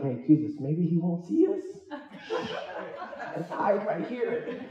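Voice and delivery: giggling, hushed hiding plea; laughing mock whisper. Himself hiding from the crazy man, laughing. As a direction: whisper to hide, breaking into laughs.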